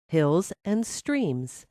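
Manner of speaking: usual speaking voice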